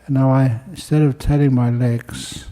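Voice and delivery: calm, unhurried meditation guide with long pauses; guided meditation. Real, not acted. Teacher guiding listeners to close their eyes and relax, calm meditation voice. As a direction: ease listeners into stillness, pausing between phrases.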